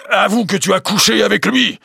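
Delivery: high, loud furious demand; choking rage. Acted. Jealous husband demanding a confession, furious high voice. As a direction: force the accusation out in fury. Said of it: "Sa voix était prise d'une telle colère".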